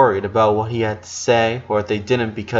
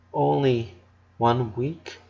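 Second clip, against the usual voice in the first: put-on quiet, fragile girl's voice asking; small hushed question; 7 dB quieter, slower than usual. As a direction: ask it barely above a breath. Lola asks quietly after bad news.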